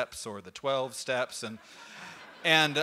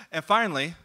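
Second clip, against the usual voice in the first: ordinary delivery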